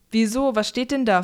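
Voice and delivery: plain reading voice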